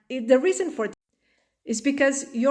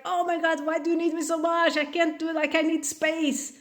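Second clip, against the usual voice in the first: higher, fast exasperated outburst; overwhelmed parent; 6 semitones higher than usual. Retold or imitated. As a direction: vent like a parent at the end of their rope. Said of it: "so overwhelmed that you're about to explode".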